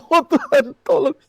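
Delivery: loud, frantic pleading to God; hammed-up desperate prayer; retold earnest prayer. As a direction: cry out for mercy in comic panic. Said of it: "saya berdoa sungguh-sungguh".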